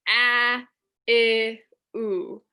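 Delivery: isolated vowel sounds; vowels sounded out. Shown as a demonstration. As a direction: sound out each vowel of gratitude. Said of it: "Three vowels is three syllables".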